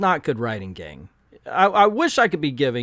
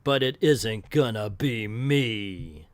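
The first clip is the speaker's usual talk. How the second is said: slow, low action-hero retort; lightly hammed tough-hero comeback; mock tough talk; 4 semitones lower, 3 dB quieter, slower than usual. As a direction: draw it out low, like a tough one-liner. Heroine's tough retort acted out.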